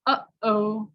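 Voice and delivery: "Uh-oh" with a catch in the throat between the vowels; "Uh-oh" cut by a throat stop; glottal uh-oh. Shown as a demonstration. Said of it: "between the two words".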